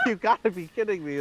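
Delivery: high, fast impersonation; incredulous actor voice, quick and high; Richard Horvitz. Retold or imitated. Actor reacting to the fan awards, imitated high and fast. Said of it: "a really good Richard Horvitz impression".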